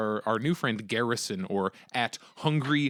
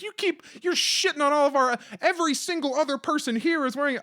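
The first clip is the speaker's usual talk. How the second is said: high-pitched re-enacted scolding; exasperated mock tirade, pitched way up; indignant heckling; 13 semitones higher than usual. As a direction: rant at them on behalf of the whole group. Re-enacting heckling a maskless man.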